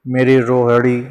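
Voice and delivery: ordinary talk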